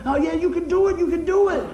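high-pitched cheering on; acted pep-talk cry; excited encouragement. Answering someone who doubts they can make it, excited encouragement.

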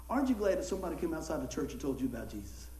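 usual speaking voice

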